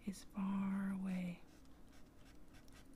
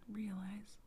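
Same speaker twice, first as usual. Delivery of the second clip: plain reading voice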